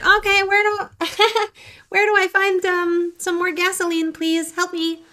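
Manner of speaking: stranded writer begging for fuel, hammed-up high whine; mock pleading. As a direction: beg like a stranded driver out of gas. Pleading for more gasoline.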